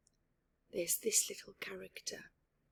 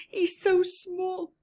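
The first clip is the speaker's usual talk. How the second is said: cooing; 11 semitones higher, 8 dB louder, slower than usual. Real, not acted. Cooing over tiny mouse.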